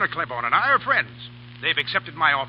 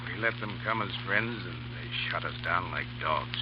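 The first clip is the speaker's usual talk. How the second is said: low, soft and slow; a hint of broken bitterness; bitter grief; 9 semitones lower, 8 dB quieter than usual. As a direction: mourn the betrayal quietly. Boss bitter after losing men.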